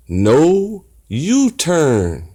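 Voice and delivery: No U-turn drawn out very slowly; read out slowly. Shown as a demonstration. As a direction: read the sign aloud, word by word. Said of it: "Read it with me".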